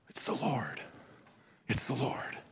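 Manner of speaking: hushed, breathless whisper of awe; awed whisper. Retold or imitated. John, stunned by the catch, whispering in awe. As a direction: whisper it as if you can't believe your eyes. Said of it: "he is going".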